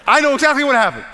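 amused laughing exclamation. Real, not acted. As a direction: laugh out the words, amused at the excuse. Preacher laughs at teens' excuse.

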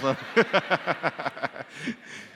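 rhythmic staccato chuckle. Real, not acted. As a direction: laugh at your own joke in a string of short bursts. Joking with the congregation.

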